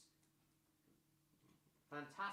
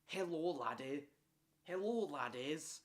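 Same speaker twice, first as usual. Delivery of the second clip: Scottish-ish laddie voice; 3 semitones higher than usual. Acted. Streamer tries another voice for Robert, light laddie greeting. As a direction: greet the lads in a hammed-up brogue. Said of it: "Is this, is this voice good enough for him?".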